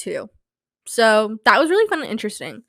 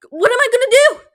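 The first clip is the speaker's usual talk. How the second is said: high whiny despair; panicked whine; 13 semitones higher, 5 dB louder than usual. Pretend. Stuck in the scenario, a high panicked wail. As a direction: throw up your hands in a high wail.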